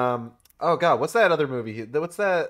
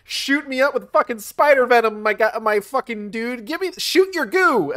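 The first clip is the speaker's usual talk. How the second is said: much higher goofy voice, laughing demand; mock laughing Harry; 11 semitones higher, 3 dB louder than usual. Mocking Harry begging for the venom, high and giddy. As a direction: crow it out like an eager fool. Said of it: "he's, like, laughing about it".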